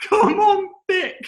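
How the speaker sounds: high mock echo of the caller, slow and drawn out; high amused mimicry of an American caller; imitating the caller. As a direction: echo her words back in her voice, delighted. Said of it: "you just let that thing come on thick".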